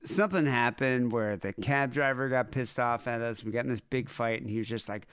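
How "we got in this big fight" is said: ordinary delivery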